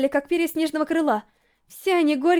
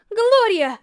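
higher, urgent cry of a name; alarmed shout; 6 semitones higher, 4 dB louder than usual. A narrator's reading. Racer crying out to her falling rival, alarmed. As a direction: shout after someone falling.

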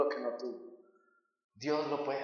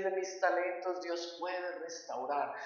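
usual speaking voice

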